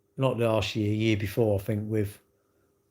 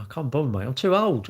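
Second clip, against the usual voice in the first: ordinary delivery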